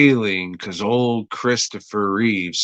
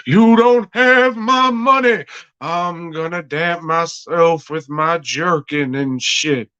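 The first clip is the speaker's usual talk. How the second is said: Southern preacher; 7 semitones higher than usual. Retold or imitated. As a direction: boom it at him like a Southern preacher demanding rent. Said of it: "like a Southern preacher going on".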